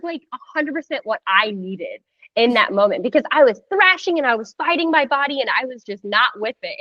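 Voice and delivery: ordinary talk, nothing marked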